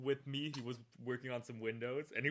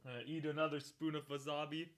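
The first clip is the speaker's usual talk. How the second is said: ordinary talk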